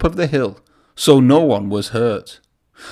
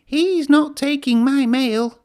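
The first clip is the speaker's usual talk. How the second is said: much higher, slow squeaky engine voice; peeping Percy; 13 semitones higher, slower than usual. A narrator's reading. Percy peeping protectively about his mail, high and small. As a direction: peep it like a little engine. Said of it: "peeped Percy".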